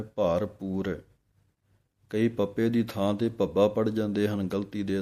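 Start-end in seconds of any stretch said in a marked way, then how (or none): 0.0-1.0 ਭਰਪੂਰ drawn out slowly as one word with a clear p; slow model ਭਰਪੂਰ; slow careful word. Shown as a demonstration. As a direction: stretch ਭਰਪੂਰ slowly, p not bh. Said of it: "ਇਕੱਠਾ ਹੀ ਬੋਲਣਾ ਹੈ".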